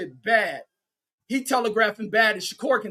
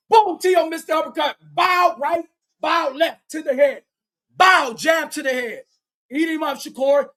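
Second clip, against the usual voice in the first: higher and louder, calling each punch; excited play-by-play; 6 semitones higher, 4 dB louder than usual. Real, not acted. Commentator calling a punch flurry in a loud excited shout. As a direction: shout the combo as it lands.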